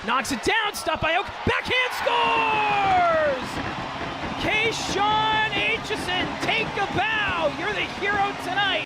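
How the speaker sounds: slow, stretched goal shout; excited goal call. Acted. Play-by-play into a big goal call.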